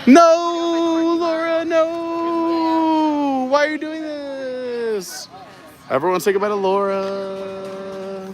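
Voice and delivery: hugely drawn-out high wail, easing down; hammed-up, stretched plea against being abandoned; mock despair. Friend is leaving him for the land, mock anguished wailing.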